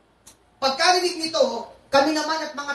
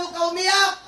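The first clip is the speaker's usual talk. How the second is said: pleading; 6 semitones higher, 3 dB louder, faster than usual. Retold or imitated. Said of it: "Sabi mo sa akin".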